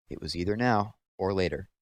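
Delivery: usual speaking voice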